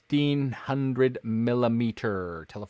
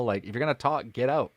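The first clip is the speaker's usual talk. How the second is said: ordinary delivery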